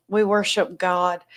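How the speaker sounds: normal talking voice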